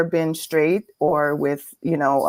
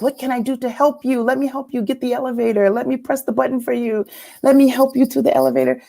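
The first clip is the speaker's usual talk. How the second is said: higher, quick fawning voice; mock eager helpfulness; 6 semitones higher, faster than usual. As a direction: imitate someone falling over himself to help. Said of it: "And his voice changed".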